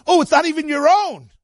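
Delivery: very high, incredulous squeal; mock astonishment. Acted reaction to the name Yaakov.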